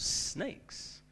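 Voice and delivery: "snakes" breathed out as a hiss on the s. Shown as a demonstration. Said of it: "What does that sound like?".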